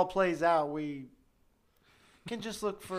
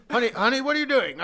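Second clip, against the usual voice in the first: husband voice; 6 semitones higher, 6 dB louder than usual. Pretend. Acting out husband at home.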